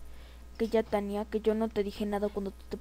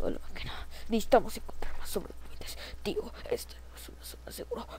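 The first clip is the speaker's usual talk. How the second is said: soft, secretive game whisper; play-acted stealthy murmur; hushed whisper; 7 dB quieter than usual. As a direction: whisper as if hiding from a machine. Hiding from the machine.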